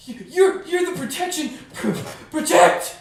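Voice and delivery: stammering, high, loud panicked plea; quivering voice. Acted. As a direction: stutter it out in panic. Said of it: "in a very quivering voice".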